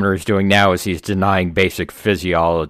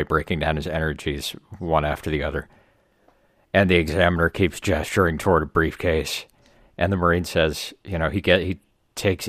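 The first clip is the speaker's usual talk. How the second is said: ordinary delivery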